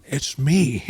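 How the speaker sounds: a lightly voiced, failed Jack Nicholson; Jack Nicholson impression. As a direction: attempt Nicholson's voice and miss. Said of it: "I was trying to do a Jack Nicholson impression".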